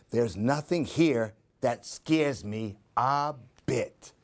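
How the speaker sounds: usual speaking voice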